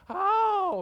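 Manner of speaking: high, loud drawn-out yelp; mock startled holler. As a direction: yelp as if sitting on something hot. Pastor's reaction to the hot seat.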